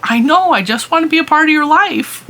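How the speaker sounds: high goofy dad pleading. Retold or imitated. Goofy telling his son Max he wants in, high goofy voice. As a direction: voice a cartoon dad pitched high and earnest. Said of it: "And Goofy responds, he's all".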